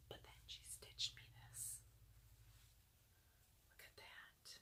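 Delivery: awed hush; whispered. Real, not acted. Vlogger revealing a stitched gift, awed whisper. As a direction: whisper it like a secret treasure.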